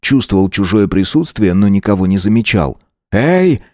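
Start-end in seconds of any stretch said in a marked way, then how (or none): 3.1-3.6 calling out. A narrator's reading. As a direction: shout out to the bushes. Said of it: "крикнул".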